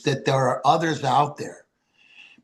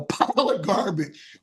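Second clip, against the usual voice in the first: ordinary delivery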